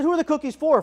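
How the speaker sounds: high, loud pointed question; sharp challenge. Retold or imitated. Doctor challenging the mother, loud and high. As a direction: call her out, loud and high.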